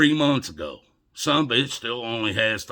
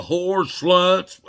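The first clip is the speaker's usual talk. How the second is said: loud sneering question, pitched up; mock insult; 9 semitones higher, 8 dB louder than usual. Ranting at viewers, a loud mocking jab. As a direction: spit it out like a sarcastic accusation.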